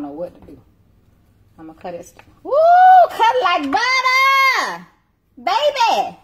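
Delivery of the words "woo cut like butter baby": excited shout. Real, not acted. Excited reaction to cutting steak.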